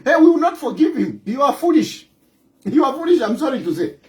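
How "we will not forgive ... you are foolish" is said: ordinary delivery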